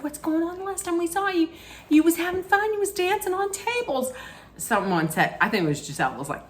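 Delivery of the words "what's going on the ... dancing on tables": put-on much higher, sing-song worried girlfriends; high-pitched gossipy concern; concerned ladies. Ladies asking the crying Shannon.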